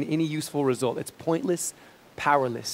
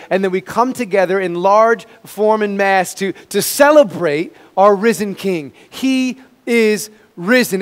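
higher and louder triumphant proclamation; preaching at full force; 5 semitones higher, 8 dB louder than usual. Acted. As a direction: shout the good news to the church.

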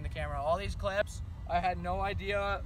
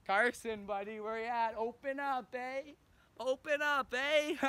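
calling out; 5 semitones higher than usual. Real, not acted. Calling out to buddy at shop.

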